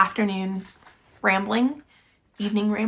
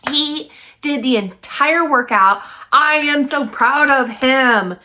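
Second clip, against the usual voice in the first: proud and excited; 3 semitones higher, 7 dB louder, slower than usual. Real, not acted. Bragging about husband's workout.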